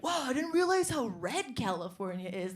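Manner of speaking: a hint of Owen Wilson's easy wonder; Owen Wilson impression. Comic mimics transplant friends as Owen Wilson, lightly voiced. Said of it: "that Owen Wilson voice".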